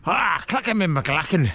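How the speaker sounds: lightly hammed-up Scottish accent; a joking stab at a Scottish accent; mock Scottish accent. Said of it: "I just start doing this Scottish accent".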